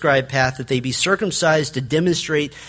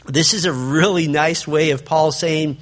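normal talking voice

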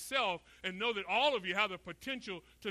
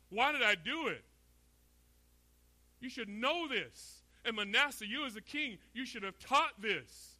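higher-pitched, lightly voiced divine reproach; impassioned questioning, slowing on the reproaches; God rebuking; 5 semitones higher, 4 dB louder than usual. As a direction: preach it as a pointed rebuke from God. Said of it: "Because what God is saying".

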